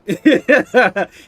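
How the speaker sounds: high, very loud burst of laughter. Real, not acted. Streamer catching a troll in chat, big laugh. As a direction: crack up loudly.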